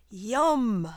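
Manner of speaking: loud, relishing yum; gleeful spider savoring its dinner; hungry spider relish. Acted. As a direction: smack your lips over the meal.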